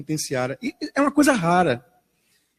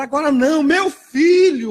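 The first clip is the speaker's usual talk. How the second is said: distraught mother; 7 semitones higher, 5 dB louder than usual. Retold or imitated. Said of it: "o repórter tentando falar com ela".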